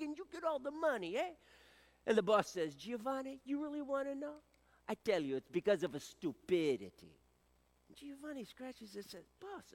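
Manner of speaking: put-on a light Italian accent for the workers and the boss; Italian joke voices.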